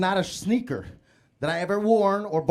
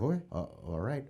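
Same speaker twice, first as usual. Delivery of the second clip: scared compliance; 6 semitones lower, 8 dB quieter than usual. Retold or imitated. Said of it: "And I was like".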